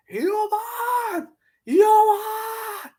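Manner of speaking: very high crying shout; mock whiny reply. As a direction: whine it back mockingly.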